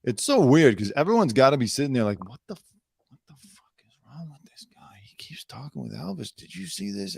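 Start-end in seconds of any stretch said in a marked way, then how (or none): 2.3-7.2 hushed, disbelieving mock whisper; secretive acted whispering; gossiping whisper. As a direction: whisper behind his back, scandalized. Imagined onlookers whispering behind his back.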